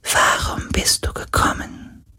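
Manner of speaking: faint, slow ghostly whisper; a fading spirit barely audible. Acted.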